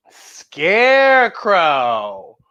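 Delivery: slow, drawn-out ghostly scarecrow call; spooky scarecrow. Pretend. Storyteller spooking the kids with a drawn-out scarecrow call. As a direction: stretch it out like a creepy scarecrow.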